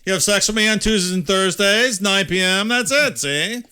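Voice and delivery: put-on old-movie 1930s gangster talk, pushed up. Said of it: "That's my nineteen thirties voice".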